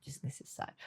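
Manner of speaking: breathy whispered "Desnecessário"; whispering. Pretend. Echo of the imagined mother.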